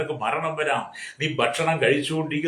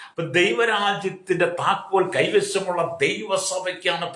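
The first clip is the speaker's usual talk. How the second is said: preaching at full force; 4 semitones higher than usual. Acted. Preacher builds to a peak.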